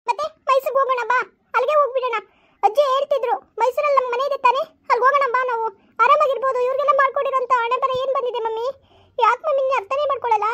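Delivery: put-on a hurt child sobbing out her complaint to mom; tearful pleading daughter. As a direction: daughter pleading with mother through tears.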